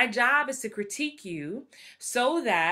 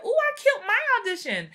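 higher, louder, fast brag; excited boast; 13 semitones higher, 5 dB louder, faster than usual. Retold or imitated. Telling a friend she killed the audition, excited. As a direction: gush about nailing it. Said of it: "I was like".

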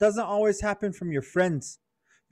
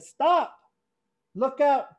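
higher, urgent call to stop; warning voice; 7 semitones higher, slower than usual. Pretend. Conscience warning the boys at the cliff, urgent. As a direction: call out the danger sharply. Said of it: "that voice came up to them and said".

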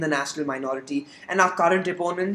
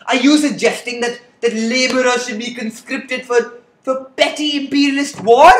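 rising outrage, ending in a near shout; indignant, building to a shout; 4 semitones higher, 6 dB louder than usual. Acted. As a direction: voice climbing higher and louder.

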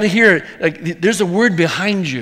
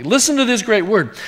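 ordinary delivery

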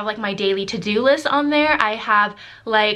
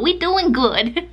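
high playful sing-song; 6 semitones higher, slower than usual. Pretend. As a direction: bounce it up playfully. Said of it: "sing-song repeat".

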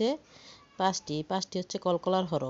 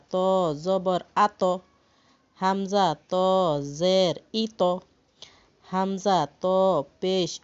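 loud, spaced letter-by-letter chanting; spelling drill; 6 dB louder, slower than usual. Shown as a demonstration. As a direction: call out each letter for the class to follow. Teacher spelling drill demonstration.

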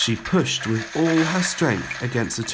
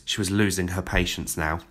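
ordinary delivery

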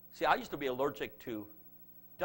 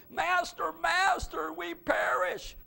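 disciples pleading; 5 dB louder, much slower than usual. A narrator's reading. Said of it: "they came to him and awoke him".

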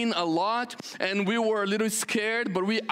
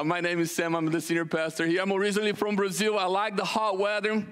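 usual speaking voice

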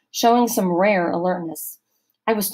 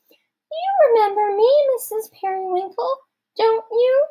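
squeaky fake girl voice, much higher; high-pitched girl's voice; 5 dB louder than usual. A narrator's reading. Rodney disguising himself as a girl for Missus Periwinkle, very high. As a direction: squeak it like a girl to fool her. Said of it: "putting on a high-pitched girl's voice".

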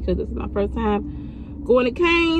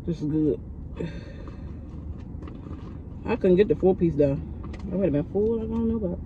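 talking between bites; muffled chewing speech; mouth full, chewing; 3 semitones lower, 7 dB quieter than usual. Real, not acted. Seen 0: Looks down at food, lips moving; eats bites before and after.

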